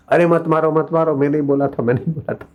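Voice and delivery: high-pitched, hurried plea to stop; acted frantic intervention, pitched well up; urgent protest. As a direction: burst in pleading for the beating to stop. Guru runs out to stop beating.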